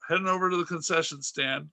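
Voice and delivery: usual speaking voice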